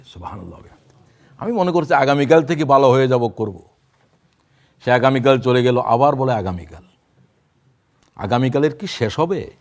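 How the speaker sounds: usual speaking voice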